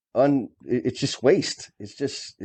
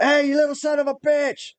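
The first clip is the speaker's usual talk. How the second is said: high-pitched mock yell across a room; retold scream. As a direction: imitate a superintendent hollering out for someone. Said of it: "scream out".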